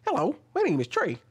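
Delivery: exaggerated high-pitched hello; put-on high voice. Shown as a demonstration. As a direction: pitch it way up, mock-formal greeting. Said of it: "you wouldn't hear my voice and be like".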